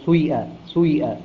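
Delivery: سويئة with a short u before the longer i; ishmam. Shown as a demonstration. Teacher explaining the compound vowel, سويئة with ishmam. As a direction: blend damma into kasra on سويئة, damma briefer. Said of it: "الضمة مقدم".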